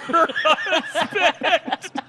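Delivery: high, drawn-out squeal of laughter; slow words dissolving into giggles; laughing hard. Real, not acted. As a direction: push the words through helpless laughter. Teller laughs through the retelling.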